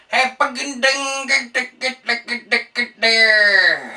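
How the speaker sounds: hammed-up store sample caller; mock the sample lady's ding ding call; imitating a sample hawker. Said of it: "some crazy person going".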